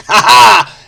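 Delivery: a loud, high burst of laughter; real amused cackle; delighted laugh. Real, not acted. Laughs at the solved pun.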